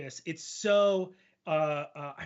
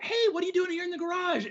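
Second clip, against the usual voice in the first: hammed-up prompt question; 12 semitones higher, 3 dB louder, faster than usual.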